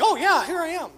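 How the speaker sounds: put-on a kid perking up at the word cookies, eager. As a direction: perk up like a kid hearing cookies. Kid suddenly responds.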